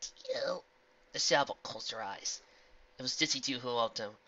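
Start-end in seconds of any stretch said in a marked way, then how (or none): 0.0-0.6 whisper. Acted. End of a whispered quote.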